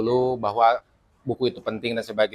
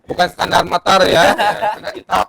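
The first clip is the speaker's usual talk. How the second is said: louder, higher, talking through laughter; laughing; 5 semitones higher, 7 dB louder than usual. Real, not acted. Host joking with guest about TikTok standards, laughing loudly. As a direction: say it while laughing, voice raised.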